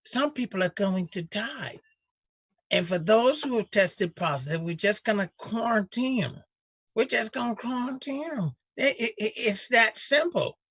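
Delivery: lightly mock the senator's good old boy accent; imitating senator's drawl. Host mocking a senator's folksy Southern drawl. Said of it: "You gotta sell that accent better".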